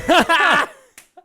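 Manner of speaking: big high burst of laughter; loud laugh. Real, not acted. Comedian laughing at his own story, loud burst. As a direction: crack up loudly at yourself.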